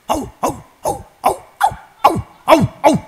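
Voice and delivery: loud high barking imitation, hau hau; dog barking. Preacher imitating a barking dog at a visitor, loud barks. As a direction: bark like the neighbour's dog. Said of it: "dengar suaranya".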